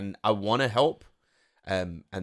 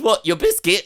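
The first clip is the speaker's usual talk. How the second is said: laughing mum quote; 11 semitones higher, 8 dB louder than usual. Retold or imitated. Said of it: "She goes".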